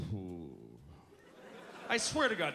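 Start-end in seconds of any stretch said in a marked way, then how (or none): 0.1-0.5 low, soft "who" that slides out; lazy, slack-lipped. Retold or imitated. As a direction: imitate loose lips, barely making an effort. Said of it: "It just slid out".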